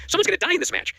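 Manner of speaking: high-pitched mock alarm at the brutal match; alarmed disbelief. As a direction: squeal it out, half laughing in disbelief. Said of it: "I'm like".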